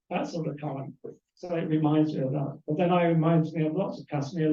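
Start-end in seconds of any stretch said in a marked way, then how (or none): none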